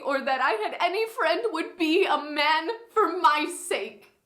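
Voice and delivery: high-pitched, impassioned Shakespearean heroine; outraged Beatrice. Acted. Beatrice rages to Benedick over her slandered cousin, impassioned and high. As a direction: play a wronged woman's champion, raging then grieving.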